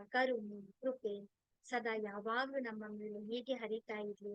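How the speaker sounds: softly intoned prayer on a level, even pitch; prayer of thanks. Real, not acted. Teacher thanking the divine powers for the class, softly recited prayer. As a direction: recite each thanks evenly, like a litany.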